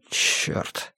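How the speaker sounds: soft, voiceless sigh; sighed curse. A narrator's reading. Erik swears after swallowing pills with wine, sighing. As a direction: let the curse out on a breath. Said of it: "вздохнул".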